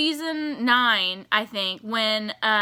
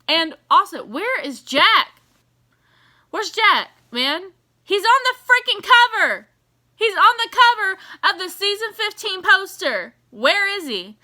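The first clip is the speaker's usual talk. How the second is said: higher, louder indignant demand; exasperated outcry; 8 semitones higher, 6 dB louder than usual. Real, not acted. Frustrated fan demanding Jack back, loud. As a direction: demand to know where he is.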